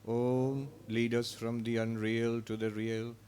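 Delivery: ordinary talk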